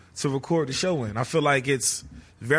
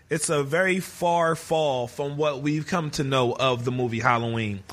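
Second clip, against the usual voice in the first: ordinary delivery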